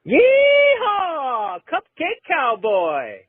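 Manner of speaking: high whooping yeehaw, then slow drawn-out cry; cowboy yell. Acted. Caller yelling his cowboy catchphrase, high and slow. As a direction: holler like a rodeo cowboy. Replayed clip.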